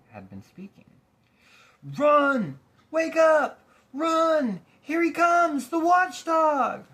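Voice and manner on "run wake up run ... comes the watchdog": high, loud cries of alarm; panicked shouting. Acted. Lethargarians fleeing the watchdog, panicked shouting. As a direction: scream a warning as you flee.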